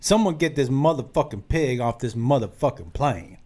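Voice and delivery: ordinary talk, nothing marked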